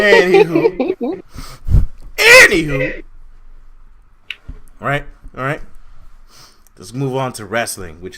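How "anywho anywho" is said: very high, loud, slow sing-song; sing-song drawn out. Real, not acted. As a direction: stretch it out like a show host. Drawn-out topic change.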